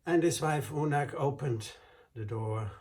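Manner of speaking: usual speaking voice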